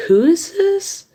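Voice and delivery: high-pitched, puzzled phone reply; puzzled questioning. Retold or imitated. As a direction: re-enact being baffled by a caller. Retells her puzzled phone reply.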